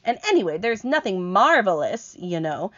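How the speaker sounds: high, loud, grumbling earthworm; indignant earthworm. Acted. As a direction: set the record straight, offended. Earthworm voiced in story.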